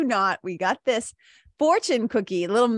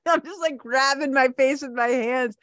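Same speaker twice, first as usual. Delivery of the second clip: ordinary delivery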